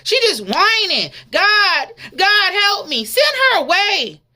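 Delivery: high, loud, exasperated dismissal; irritated disciples. Acted. Disciples brushing off the woman, loud and irritated. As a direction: shoo her off, fed up. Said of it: "was irritated".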